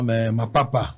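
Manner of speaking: ordinary talk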